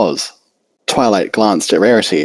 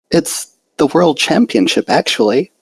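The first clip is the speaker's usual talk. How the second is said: shy, hesitant little pony voice, lightly pitched up; timid Fluttershy; 8 semitones higher than usual. A narrator's reading. Fluttershy admits the contest.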